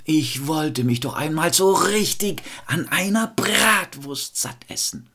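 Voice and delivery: high, longing wail, dropping at the end; storyteller's famished mother wishing aloud; hungry mother exclaiming. A narrator's reading. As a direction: moan with hungry craving. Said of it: "so dass sie rief".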